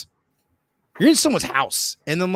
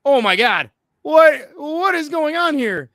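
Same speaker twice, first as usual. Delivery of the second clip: high, loud, drawn-out panic; hammed-up alarmed wail; retold freaking out; 12 semitones higher, 6 dB louder, slower than usual. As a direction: act out mounting panic, climbing high. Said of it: "freaking out".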